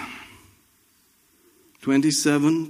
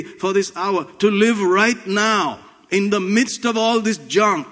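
fervent preaching; 6 semitones higher, slower than usual. Real, not acted. Preaching at full force.

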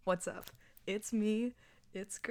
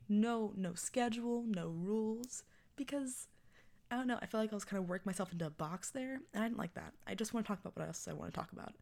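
normal talking voice

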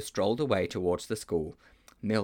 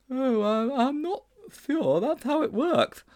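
put-on high, hesitant slow boy's voice; high-pitched schoolboy doubt; reluctant Sponge; 4 dB louder, slower than usual. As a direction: stall nervously up in a high register. Sponge balks at going.

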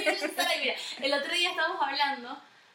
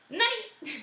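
dad calling Nani; 10 semitones higher than usual. Retold or imitated. Dad calling mom at the door, high cooing voice. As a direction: call her name like coaxing a puppy. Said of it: "pero de una manera muy, muy".

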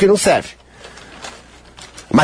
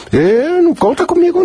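ordinary talk

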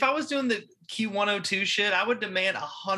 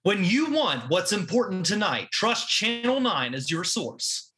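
slow, weighty channel promo read; news promo voice; 3 semitones higher, slower than usual. Pretend. Cohost joining in with a slow mock news promo. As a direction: sell the station like a TV tagline. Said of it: "you have to talk like this".

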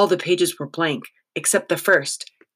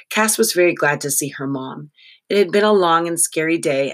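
ordinary delivery, nothing marked

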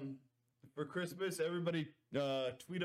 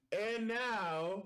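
drawn-out, higher-pitched announcement; show introduction; 4 semitones higher than usual. Acted. Host keeping up the special's tradition, drawn-out announcement. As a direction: build it up like a big reveal. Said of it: "keep that tradition up and go".

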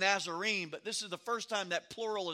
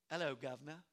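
put-on quiet, low English-accented greeting; mock English accent; 6 semitones lower, 10 dB quieter, faster than usual. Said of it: "speak with an English accent".

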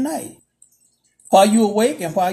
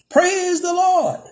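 very high, slow Praise the Lord; church lady's praise; 6 semitones higher, slower than usual. Retold or imitated. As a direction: sing it out high like the old lady. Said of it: "then says".

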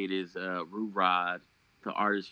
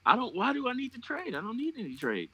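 high, incredulous objection; high-pitched protest; faster than usual. Real, not acted. Co-host insists he needs no trades, high-pitched protest. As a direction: push the voice way up in protest.

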